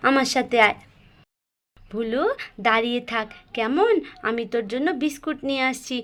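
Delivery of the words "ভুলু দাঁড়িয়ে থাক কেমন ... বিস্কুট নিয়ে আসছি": gently calm a growling dog down; soothing the dog. Acted. Sister promising the dog biscuits.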